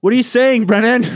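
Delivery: very loud, high holler; full-volume call; shouting. Real, not acted. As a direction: yell the question to someone outside. Shouting to Brennan outside.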